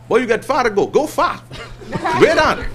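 high, laughing local voice giving useless directions; a lightly mocked beach local, amused; imitating island locals.

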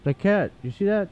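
high, delighted oh my goodness; amazed. Real, not acted. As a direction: squeal at the surprise. Spots a cat, amazed.

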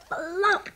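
high, popping flop; imitated flop. Sheepdog lands on the wet bench, popped-out high flop. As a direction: plop the word out like a sound effect. Sound of dog landing on bench.

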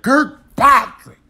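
long, loud, high mock lash on the name; loud slow whip-crack name. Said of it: "It was like".

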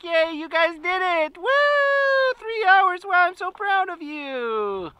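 high, loud cheering cactus; happy cactus voice. Retold or imitated. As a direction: cheer the kids on as a delighted cactus. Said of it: "He's like".

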